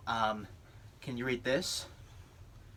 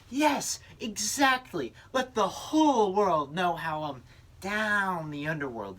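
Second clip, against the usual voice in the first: eager; 7 semitones higher, 4 dB louder, slower than usual. Acted. Devil eager for the commercial.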